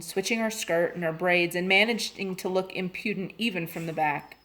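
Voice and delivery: ordinary delivery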